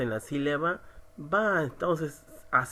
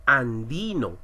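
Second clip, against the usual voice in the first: 'Andino' with the weight on 'di'; stress on DI; 3 semitones lower, 3 dB louder than usual. Shown as a demonstration. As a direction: lean on DI in 'Andino'. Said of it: "La mayor fuerza de voz la tenemos en di". ('Andino' marcando la sílaba 'di')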